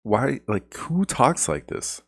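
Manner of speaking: who talks like this, truly baffled; exasperated disbelief. Retold or imitated. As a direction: throw up your hands in disbelief. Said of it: "I'm like".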